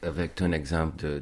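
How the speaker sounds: ordinary talk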